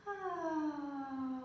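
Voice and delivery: a happy little gasp at the mirror; delighted gasp. A narrator's reading. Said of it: "and went".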